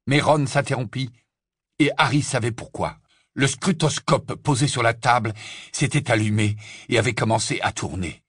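usual speaking voice

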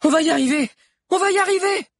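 very high, loud encouraging shouts; rally your friend with excited cries; high-pitched rallying yell; cheering each other on. A narrator's reading. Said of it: "Sabi et Alix s'encourageaient".

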